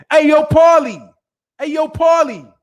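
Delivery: high, loud playful holler; mock calling out. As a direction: holler a name as if across the street.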